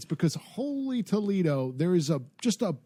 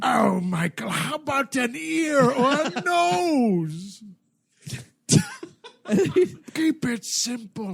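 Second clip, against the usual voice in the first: mock villain voice; 5 semitones higher than usual. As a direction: taunt him playfully. Mocking villain voice.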